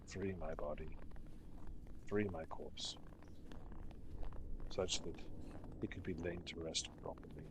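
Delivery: low, soft, breathy ghostly lament; a lost spirit grieving over rubble, quietly; mournful ghost. Acted. Ghost laments the fall of Ythryn, low and whispery.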